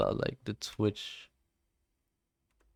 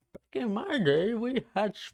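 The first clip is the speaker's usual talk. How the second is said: put-on a light, higher-pitched grumpy old-timer; mock old man; 9 semitones higher than usual. Eighteen-year-old streamer mocks feeling old, light grumpy old-man voice. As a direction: lightly play a grumbling grandpa. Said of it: "Like, I felt fucking old".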